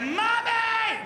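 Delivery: put-on high, loud childlike cry; hammed-up child scream; terrified child scream. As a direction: scream for mom like a scared kid. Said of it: "I was like".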